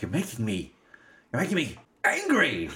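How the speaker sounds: imitating mounting frustration, pitch jumping on "angry"; mock exasperation, rising to a squeal; frustrated anger. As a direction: build from a stammered start to a high outburst. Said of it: "she's getting really frustrated".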